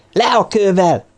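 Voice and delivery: high, forceful shouted order; huge shout. A narrator's reading. Sneaking up behind the man holding a rock, huge shout. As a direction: startle them with a sudden yell. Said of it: "majd hatalmasat kiáltott".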